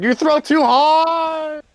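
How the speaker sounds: high, loud, long-drawn wail; complaining yell. Acted. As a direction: cry out while being flung away. Mage Hand thrown into a tree.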